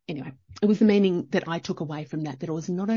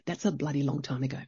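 normal talking voice

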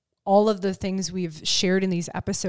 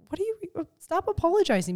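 much higher, incredulous; mock disbelief at the apology; a high, exasperated "stop apologizing"; 11 semitones higher, faster than usual. Exasperated reply to client.

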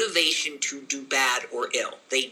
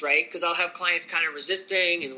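normal talking voice

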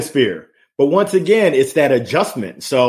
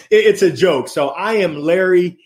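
ordinary talk, nothing marked

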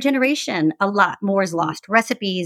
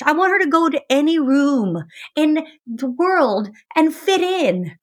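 imagined mom; 7 semitones higher than usual. Retold or imitated. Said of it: "I'm imagining my mom".

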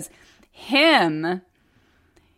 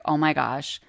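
imitating husband apologizing; 3 semitones higher than usual. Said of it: "he comes into the room and he's like".

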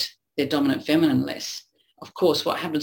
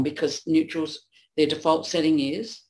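ordinary talk, nothing marked

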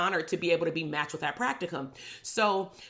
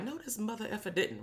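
indignant under breath; 3 semitones higher, 10 dB quieter than usual. Retold or imitated. Indignant inner reaction.

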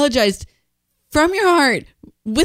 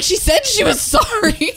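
retold yell; 7 semitones higher than usual. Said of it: "yelling at the lady".

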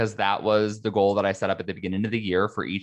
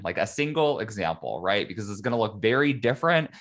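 normal talking voice

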